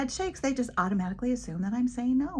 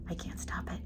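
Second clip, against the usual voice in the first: near-whisper, much softer; hushed admission; 9 dB quieter than usual. Retold or imitated. Teacher admits to little boy she cannot stop it, hushed. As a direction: confess it under your breath.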